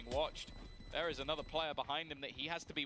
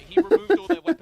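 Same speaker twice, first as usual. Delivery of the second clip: excited yell; 19 dB louder than usual. Real, not acted. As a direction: hype shout. Caster hyped about the play.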